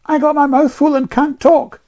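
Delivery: put-on high, loud indignant child; Jane on her dignity. A narrator's reading. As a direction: retort as an affronted little girl. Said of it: "replied Jane with dignity".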